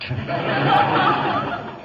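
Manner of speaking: breathy scornful chuckle; snide laugh at the hostess; mocking laugh. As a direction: laugh at your own insult. Whiteside mocks his hosts.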